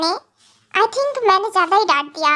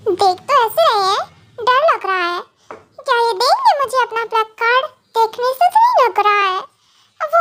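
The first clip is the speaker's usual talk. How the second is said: higher, sweet-talking wife asking a favour; coaxing young wife; 6 semitones higher than usual. Acted. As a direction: wheedle the husband, half laughing. Wife pleads for the card. (ऊँची, मनाती हुई पत्नी)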